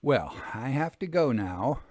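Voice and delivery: usual speaking voice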